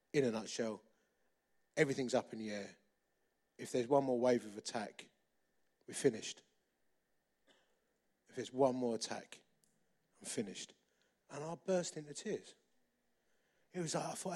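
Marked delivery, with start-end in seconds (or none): 0.1-10.7 lightly imitated clipped English officer, low-pitched; hint of a clipped English accent, grave pauses between phrases; understated English RAF officer accent, low and measured. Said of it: "in a very clipped English accent".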